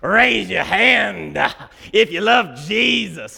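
hammed-up revival preacher voice; big sweaty preacher. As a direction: play the hot, sweaty preacher calling out to the congregation. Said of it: "he's like".

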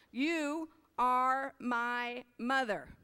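very slow, lingering tender reading. Shown as a demonstration. Repeating the sweet last line to listeners, slow and tender. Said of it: "isn't that sweet".

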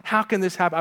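slow, rising indignant complaint; mock outrage. Preacher voicing someone hit by trouble, slow indignant complaint. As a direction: protest the unfairness. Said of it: "what would you say that to".

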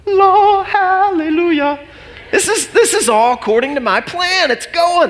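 loud, high jubilant hallelujah; ecstatic praise. Retold or imitated. Pastor rejoicing at the sale, loud high praise. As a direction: shout praise to the sky.

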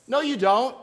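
ordinary delivery

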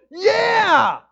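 one big high, loud cheer; joyful yell. Pretend. Pastor showing natural praise, a loud cheer. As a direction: shout it out in delight. Seeing something amazing.

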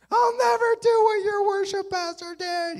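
very high, loud comic squeal; panicked promise. Retold or imitated. Student promising angry chancellor, hammed-up frantic terror. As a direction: swear it like a terrified student.

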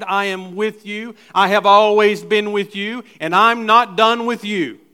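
loud, rising declaration; preaching at full force; proclaiming. Acted. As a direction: build up and proclaim it to the church. Preacher declaring God's promise.

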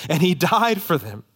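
ordinary talk, nothing marked

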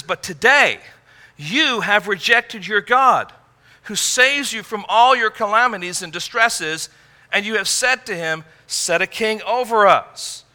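God accusing Israel of rejecting him; God's stern rebuke. Acted. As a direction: press the accusation home. Preacher voices God's indictment.

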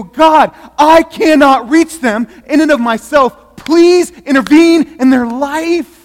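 loud, high, slow desperate plea to God; pleading prayer. Shown as a demonstration. Congregation praying for the least likely, loud pleading. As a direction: cry out to God with everything. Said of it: "and we go".

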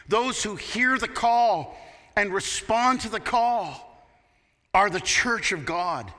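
full-force sermon delivery; preaching. Acted.

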